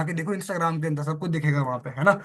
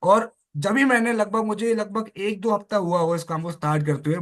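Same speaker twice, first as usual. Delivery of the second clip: ordinary talk, nothing marked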